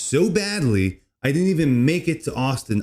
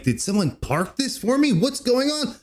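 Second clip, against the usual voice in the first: retold disbelief; 11 semitones higher than usual. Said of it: "I'm like".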